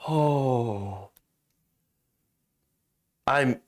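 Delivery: ordinary talk, nothing marked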